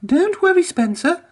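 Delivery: very high, bright reassurance; tooting Emily. A narrator's reading. Emily reassures Spencer, very high. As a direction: toot it up high to calm him. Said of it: "tooted Emily".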